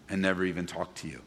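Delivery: usual speaking voice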